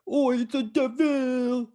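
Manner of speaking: mocking some blokes with a Cockney voice. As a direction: drawl it like a cheeky geezer. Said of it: "some blokes were like".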